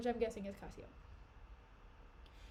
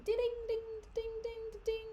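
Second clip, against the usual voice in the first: imitated bell; 9 semitones higher than usual. Bell ringing in the play.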